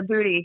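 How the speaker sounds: "booty", with a long oo for Buddy's short u; Buddy misspoken as "booty"; mispronounced Buddy. Retold or imitated. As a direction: imitate the slip, saying "booty" with an oo. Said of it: "didn't know how to say it right".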